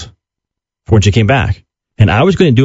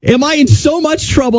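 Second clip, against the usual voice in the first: high-pitched mock panic, much higher than his own voice; panicked. Dad panicking over his wife's ruined car, high and squealing. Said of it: "I start freaking out going".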